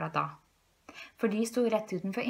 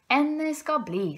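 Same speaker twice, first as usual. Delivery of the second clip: high-pitched, lightly voiced fox husband; Herr Rev, villig ektemann; 7 semitones higher, 4 dB louder than usual. A narrator's reading. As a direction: agree gallantly, pitch lifted. Fox husband grandly agrees. (lett spilt, høy revestemme)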